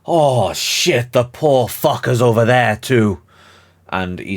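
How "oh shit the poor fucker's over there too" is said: higher, louder startled outburst; shocked exclamation. Acted. Devos spots the prisoner and exclaims in shock. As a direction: blurt it out, caught off guard. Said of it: "Devos just goes".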